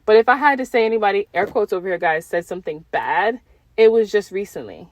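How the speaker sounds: normal talking voice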